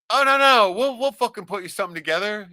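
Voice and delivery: high loud insisting aunties; eager aunties. Retold or imitated. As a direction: brush off his excuse eagerly.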